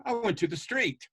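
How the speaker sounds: teacher's correcting tune on street; corrected with intonation. Shown as a demonstration. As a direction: lift the word street as a quick correction. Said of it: "And I go".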